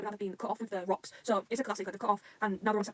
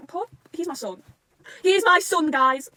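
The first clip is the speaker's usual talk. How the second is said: louder, higher fan declaration; loud protective cry; 6 semitones higher, 9 dB louder, much slower than usual. Real, not acted. Fan to viewers, loudly declaring love for character. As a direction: claim him loudly as your own.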